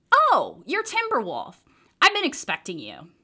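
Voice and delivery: a light lift into a friendly pharmacist. A narrator's reading. Pharmacist greets the boy she expected, lightly voiced. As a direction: greet him like you knew he'd come.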